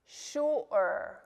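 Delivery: "sure" split as shu-er by a throat catch; glottal stop. Shown as a demonstration. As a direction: break "sure" in two, shu'-er, drawn out. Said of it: "make that little stop in your throat".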